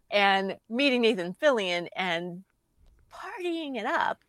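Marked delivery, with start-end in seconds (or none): 3.1-4.2 high, sing-out party voice; high-pitched and teasing; playful revelry. Retold or imitated. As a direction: lift it high with playful relish. Imagining the missed party.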